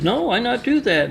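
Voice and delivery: high broken-English mock accent; mock Chinese accent. Boss Ming quoted in broken English.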